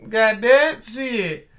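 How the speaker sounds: slow, loud, high swearing; exasperated cursing. Real, not acted. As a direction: drag the curse out in exasperation. Ranting about women staring.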